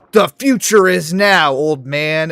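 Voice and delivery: Kwebbelkop. Retold or imitated. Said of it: "remember when Kwebbelkop was like".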